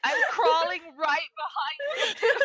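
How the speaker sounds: loud laughing yell; laughing shout. Real, not acted. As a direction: shout it while cracking up. Laughing shouting crawling after.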